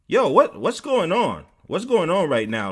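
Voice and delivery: high, loud questioning; imitated residential advisor. Advisor sensing tension in the room, high loud demand. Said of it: "she was like".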